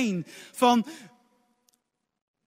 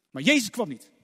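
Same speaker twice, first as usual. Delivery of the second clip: normal talking voice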